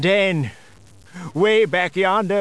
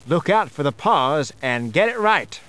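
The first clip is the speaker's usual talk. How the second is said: plain reading voice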